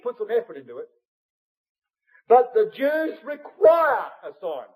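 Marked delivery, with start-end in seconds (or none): 2.3-4.7 loud and higher, slowed, hammering require; preaching shout. Real, not acted. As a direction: drive the charge home at full force. Preacher thunders the point.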